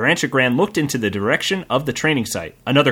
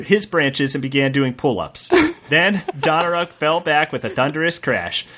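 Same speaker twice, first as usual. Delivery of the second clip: ordinary talk, nothing marked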